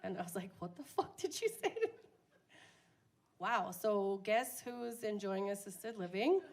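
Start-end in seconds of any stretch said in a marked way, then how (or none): 0.6-1.9 high, indignant squeak; mock outrage. Answering her mom's insult, high mock outrage. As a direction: act offended, way up high.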